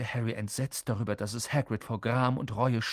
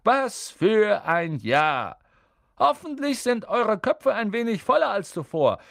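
put-on high, loud, merry old wizard speech; jovial old headmaster; 11 semitones higher, 5 dB louder than usual. Dumbledore addressing the students at the feast, high and loud. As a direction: address the hall with twinkling good humour.

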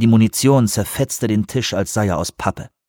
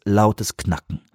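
usual speaking voice

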